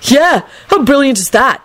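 high, gleeful chatter; excited. A narrator's reading. Neville celebrating with excited Trevor, excited. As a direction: revel in the news.

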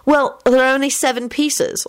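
high, puzzled query; mild, high-pitched bafflement. A narrator's reading. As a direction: point out the problem, puzzled. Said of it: "said in a puzzled voice".

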